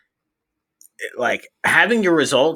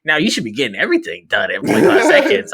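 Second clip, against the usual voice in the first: ordinary talk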